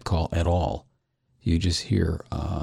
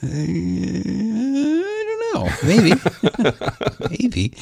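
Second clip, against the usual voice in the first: coy drawl; 12 semitones higher, much slower than usual. Real, not acted. Teased about compensating.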